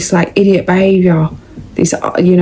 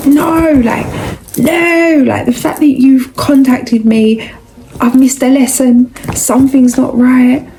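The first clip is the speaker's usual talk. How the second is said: exasperated refusal; 6 semitones higher than usual. Retold or imitated. Exasperated at ex's message.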